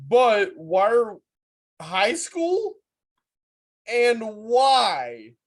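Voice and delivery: loud, high disbelieving outburst; incredulous shout. Real, not acted. Friend shouting in disbelief at the lecture story. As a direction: yell it out in shock.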